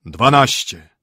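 loud booming toll; bell. A narrator's reading. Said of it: "rzekł dzwon".

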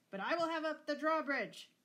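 high, loud threat from an overbearing father; angry bellow. A narrator's reading. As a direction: overrule her at full voice. Bellowing father quarrels with daughter.

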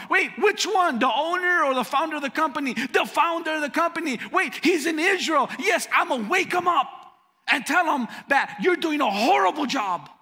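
threatening to wake the founder; angry caller. Retold or imitated. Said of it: "when he was screaming at me".

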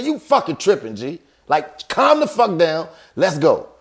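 high-pitched retold exasperation; mad. Telling his friend to calm down and leave, angry. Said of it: "Now I'm mad at James".

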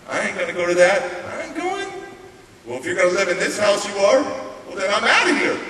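heated back-and-forth argument, retold; teen quarrel with foster dad. Teenager and foster dad argue over the program, defiant.

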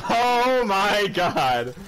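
very drawn-out disbelieving exclamation; slow, stunned gamer shout; amazed outcry. Real, not acted. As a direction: stretch every word in amazement. Exclaims at a lucky ray gun.

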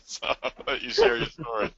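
talking through a laugh; real laughter. Real, not acted. Host handing over to a guest, laughing. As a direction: laugh your way through the handover.

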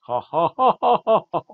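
imitated high-pitched mock laugh; put-on taunting cackle. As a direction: fake "ha ha ha" to taunt someone. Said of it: "[name] kinda mocks Corbin by saying".

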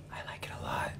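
hushed secret aside; whisper. Real, not acted. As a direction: breathe it like a confession.

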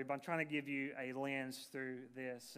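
usual speaking voice

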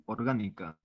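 normal talking voice

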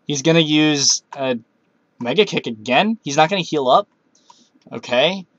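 usual speaking voice

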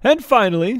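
high, loud, grand announcing; formal little girl. Acted. Jane introducing Leo to her court, grandly. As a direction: introduce him like a herald.

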